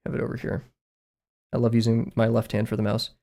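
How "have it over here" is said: unvoiced whisper to himself; whispered mutter while working; whispered aside. Real, not acted. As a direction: breathe it out under your breath.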